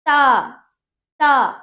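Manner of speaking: ता loud, with a long full vowel. Shown as a demonstration. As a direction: give ता its whole drawn-out aa. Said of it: "ता होता तो पूरा ता होता".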